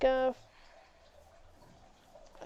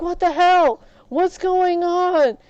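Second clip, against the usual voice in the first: loud, raised-pitch demand to know; alarmed shout; 7 semitones higher, 9 dB louder than usual. Retold or imitated. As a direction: call out as if bursting in on chaos. Acts alarmed George reacting to raging.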